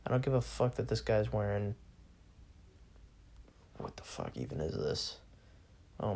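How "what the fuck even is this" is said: hushed, disbelieving whisper; whispered what-is-this; baffled whisper. Real, not acted. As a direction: breathe it out in disbelief. Baffled by an Instagram post.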